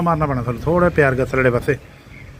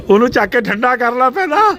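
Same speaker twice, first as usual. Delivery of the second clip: dramatic loud aunty; 8 semitones higher, 4 dB louder than usual. Real, not acted. Urgent instruction in kitchen.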